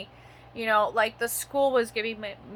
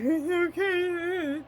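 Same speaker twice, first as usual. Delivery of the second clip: mock postman; 7 semitones higher, 5 dB louder, much slower than usual. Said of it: "He's like".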